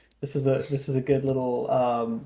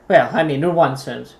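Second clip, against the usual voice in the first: usual speaking voice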